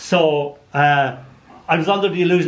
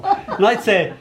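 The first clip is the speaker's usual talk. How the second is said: usual speaking voice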